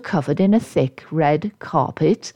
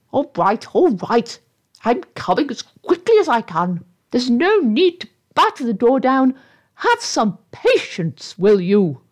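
higher, testy voice from inside; mild impatient shout; 5 semitones higher than usual. Acted. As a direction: call out irritably, without full volume. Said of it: "heard a voice shouting from inside the tree".

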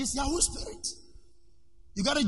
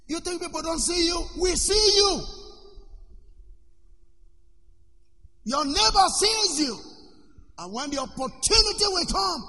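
preaching shout; 7 semitones higher than usual. Acted. Preacher warning his congregation.

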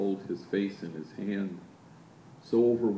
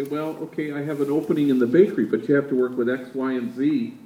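fast, louder run through the list of names; rattling off; 3 semitones higher, 8 dB louder than usual. Retold or imitated. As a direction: reel the names off quickly. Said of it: "I rattle off the names".

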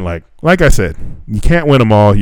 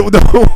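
high burst of laughter; 11 semitones higher, 6 dB louder than usual. Real, not acted. Laughs at friend's joke.

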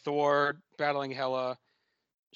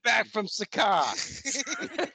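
put-on Boston accent; 10 semitones higher, 3 dB louder, slower than usual. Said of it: "Odin Boston voice continues".

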